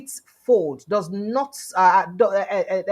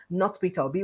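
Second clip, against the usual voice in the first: ordinary talk, nothing marked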